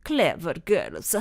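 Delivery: low, slow spat words; bitter and contemptuous. A narrator's reading. Rossi to the girls, low and spitting. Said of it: "he spat".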